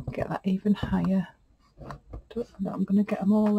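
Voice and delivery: breathy whisper under the breath; a private whispered aside; whispered to self. Real, not acted. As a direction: whisper it to yourself while working. Muttering to herself while arranging.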